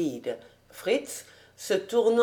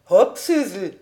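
short louder heave-ho call; exclaiming; 5 dB louder than usual. A narrator's reading. Man lifting the girl into the cart, brisk exclamation. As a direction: call it out as you lift someone. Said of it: "en s'écriant".

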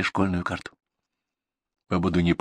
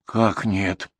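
bewildered. Acted. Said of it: "недоуменно воззрилась".

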